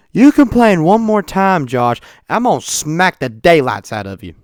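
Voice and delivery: loud, drawn-out warning at first, then dropping; hammed-up telling-off, slow and high to start; acted scolding threat. Lady on the trip threatens complaining Josh, mock scolding.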